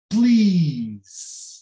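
Please with a very drawn-out ee; long I sound. Shown as a demonstration. Teacher showing the I sound in Please, long ee. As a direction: stretch the ee in Please right out. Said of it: "é o som I".

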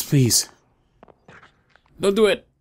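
loud, high begging; desperate plea. Real, not acted. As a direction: plead with it not to. Begging the monster.

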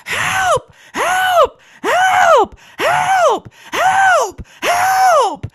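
very high, loud repeated shrieks; shrieking. Acted. Patient shrieking for help while grabbing Chris, very high and loud. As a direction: scream for help at the top of your lungs. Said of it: "before shrieking again".

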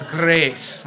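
GRACE with a hard-rolled r, drawn out in mock Scottish; hammed-up Braveheart Scots on a long GRACE; bad Scottish accent. Teacher mimicking the video speaker for the class, bad Scottish accent. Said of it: "like, a kind of a bad Scottish accent".

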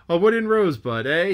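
a mate's loud jeer at a ship's name; Stubb crying out. Acted. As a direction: call it out with a sailor's relish. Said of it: "he cried".

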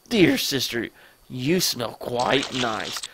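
low, slow, creepy compliment; creepy brother. Acted. Creepy brother sniffs his sister.

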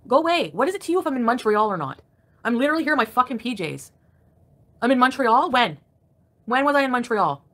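real anger. Real, not acted. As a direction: fire back at the commenters, fed up and indignant. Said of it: "I'm just angry".

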